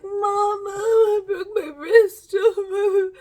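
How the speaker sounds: high, loud, drawn-out wail; hammed-up tearful plea; mock crying. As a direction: wail to mom like a hurt kid. Acting out her injured younger self.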